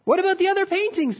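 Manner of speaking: retold crowd shouting in outrage; outraged shout. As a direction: protest in disbelief. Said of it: "people shouted".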